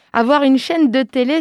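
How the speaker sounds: ordinary delivery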